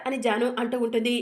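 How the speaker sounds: ordinary delivery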